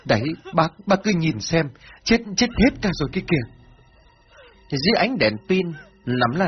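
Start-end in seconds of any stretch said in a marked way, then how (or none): 0.1-3.5 high, tearful acted lament; distraught wail rising in pitch; choked sobbing. A narrator's reading. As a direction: point out the loss, voice choked and climbing. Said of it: "nấc lên từng tiếng nghẹn ngào".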